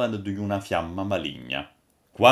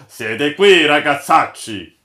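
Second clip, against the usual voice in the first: loud, raised hail; exclaiming; 5 semitones higher, 9 dB louder, slower than usual. A narrator's reading. Old master greeting the seated crew, exclaiming loudly. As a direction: call out to the rascals with full voice. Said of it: "esclamò".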